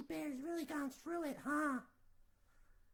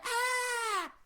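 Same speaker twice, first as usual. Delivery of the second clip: scream; 7 semitones higher, 11 dB louder than usual. Acted. Goldilocks screams waking up.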